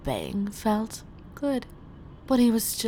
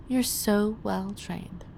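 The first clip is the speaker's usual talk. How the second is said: usual speaking voice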